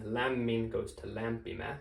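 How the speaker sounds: "Lämmin goes to lämpimä" said slowly, each Finnish word spelled out clearly; slow Finnish demo. Shown as a demonstration. Teacher showing a student how lämmin becomes lämpimä, slow and clear. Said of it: "kind of a weird way to form".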